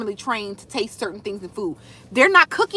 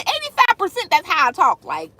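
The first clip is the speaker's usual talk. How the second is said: ordinary delivery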